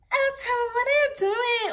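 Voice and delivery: put-on high, sing-song snooty girl voice; snob girl. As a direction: play the posh girl looking down on him. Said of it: "She's a snob girl".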